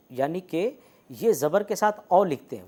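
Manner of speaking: ordinary talk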